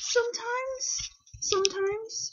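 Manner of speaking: slow, very high drawn-out "sometimes"; high-pitched admission. Real, not acted. Admitting to viewers they sometimes regret it, high-pitched. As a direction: squeak the admission out slowly, way up high.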